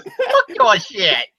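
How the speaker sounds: slow, cackling villain voice; Green Goblin. Acted. Imitating classic Goblin for co-host, slow cackling villain voice. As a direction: drag it out as a laughing villain. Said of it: "classic Green Goblin voice you hear".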